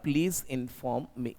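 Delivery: slow, carefully spaced model sentence; slow dictation. Shown as a demonstration. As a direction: dictate it word by word to the class. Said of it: "तो यहां पे आपको बोलना पड़ेगा".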